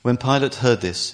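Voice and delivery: usual speaking voice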